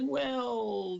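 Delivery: long, high drawn-out "well"; hesitant stalling. Retold or imitated. Doctor reluctant to admit the report is missing, hesitant stalling. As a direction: imitate a doctor stalling, pitch sliding down.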